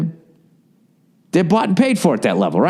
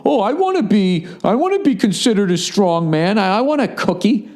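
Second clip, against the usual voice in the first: whiny mock man; 5 semitones higher than usual. Podcaster mocking a needy man, whiny and high. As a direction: sneer like a needy boy begging. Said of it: "you know, go".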